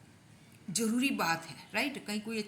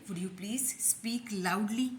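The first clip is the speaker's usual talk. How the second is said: each word stretched out very slowly; slow drill; much slower than usual. Shown as a demonstration. Teacher drilling the polite request very slowly. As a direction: repeat it slowly so they can copy. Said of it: "क्या बोलें?".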